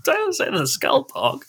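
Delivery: put-on pleading kid voice. Pleading as a child.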